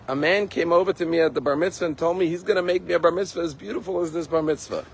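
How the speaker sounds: high, fast, breathless rush of news; a boy bursting to tell his mother; excited boy. Acted.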